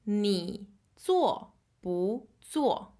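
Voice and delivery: each syllable drawn out slowly; careful slow classroom model; slow demonstration. Shown as a demonstration. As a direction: read it slowly for learners to copy. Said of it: "let's see how it's used".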